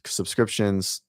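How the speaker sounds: ordinary talk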